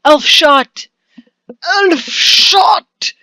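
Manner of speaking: elf shot shouted high and loud, not hissed; hissed alarm. Acted. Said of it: "he hisses".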